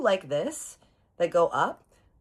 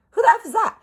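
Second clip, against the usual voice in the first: much higher and louder, stunned; shocked question; 8 dB louder, faster than usual. Retold or imitated. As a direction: blurt it out in disbelief. Spots unknown boy in photo.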